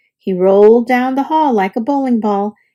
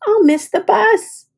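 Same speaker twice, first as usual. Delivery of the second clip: high, whiny worry; high anxious whine; worried wail; 9 semitones higher than usual. A narrator's reading. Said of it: "he cried".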